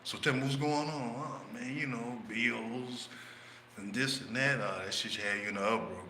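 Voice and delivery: calm him down gently; nice and calm. Retold or imitated. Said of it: "talking to him nice and calm".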